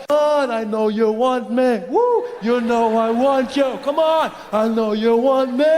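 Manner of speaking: slow, drawn-out sleazy come-on; pushy creep, oozing persistence; creepy guy. Acted. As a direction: stretch the words like a leering creep. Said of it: "That creepy guy comes up".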